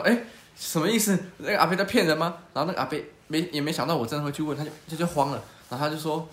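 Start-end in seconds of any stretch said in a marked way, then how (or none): 0.0-2.4 puzzled surprise. Retold or imitated. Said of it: "我就想".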